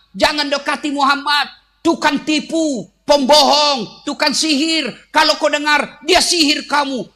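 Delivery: high, loud street yelling; a blind man in the market yelling warnings to passers-by; shouting blind man. Acted. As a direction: rail at the crowd to stay away. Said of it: "Berteriak-teriak".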